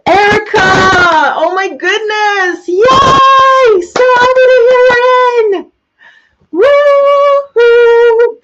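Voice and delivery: very high, loud shrieks of joy; overjoyed welcome to a favourite client; ecstatic squealing. Real, not acted. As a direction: scream with delight at her friend. Greeting fans in live chat.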